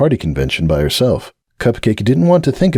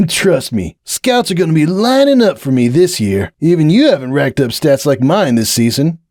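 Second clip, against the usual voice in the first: higher, bragging colt; boastful Hoops; 7 semitones higher than usual. Acted. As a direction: show off his stats.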